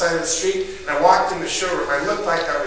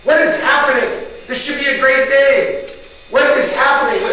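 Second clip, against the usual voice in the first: scolding himself loudly; 10 semitones higher, 11 dB louder than usual. Retold or imitated. Yelling at himself in truck.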